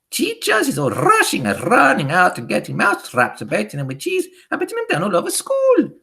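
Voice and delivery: high, excited witch harangue; shrieking witch speech to the coven; Grand High Witch. A narrator's reading. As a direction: rant gleefully with rolled r's and an accent. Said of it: "shouted the Grand High Witch".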